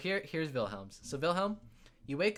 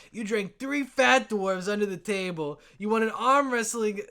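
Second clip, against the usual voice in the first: loud, high, admiring boasts; excited recounting; 7 semitones higher, 7 dB louder than usual. Acted. Dwarves recount your legendary antics, rowdy excitement. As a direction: hype up a friend's exploits one by one.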